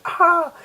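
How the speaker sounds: loud, very high-pitched shriek; mock scream. Girl seeing Ankh with the core metal, high mock scream. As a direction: imitate a girl's startled shriek.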